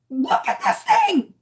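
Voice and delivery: hammed-up squealed alarm; mock panic, strained and rising at the end; retold freak-out. Customer calling waitress over to see bug, panicked.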